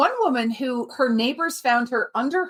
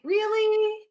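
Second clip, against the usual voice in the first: incredulous; 10 semitones higher than usual. Retold or imitated. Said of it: "it's kinda like".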